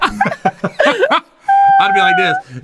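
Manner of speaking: very slow, high, laughing; dragged out through laughter. Pretend. Laughing, drawn-out playful complaint.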